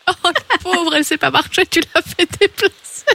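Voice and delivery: rire dans la voix, plus aigu; hilare. Real, not acted. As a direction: plaindre quelqu'un en riant franchement. Amusé par la vieille dame piégée. (voix aiguë, secouée de rire)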